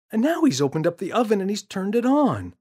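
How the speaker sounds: put-on boy's voice. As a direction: kid reporting the mess, building up. James describes.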